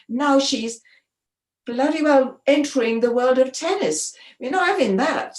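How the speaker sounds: mock indignant. Press photographers scoffing about her entering tennis, hammed-up outrage. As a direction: sneer it like offended old pressmen.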